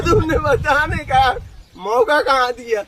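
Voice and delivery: loud, high burst through laughter; laughing. Real, not acted. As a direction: retell the retort while laughing. Laughs while retelling his reply.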